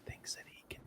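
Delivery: soft unvoiced whisper into the mic. Real, not acted. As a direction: whisper so the stream barely hears. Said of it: "can you hear me when I'm whispering".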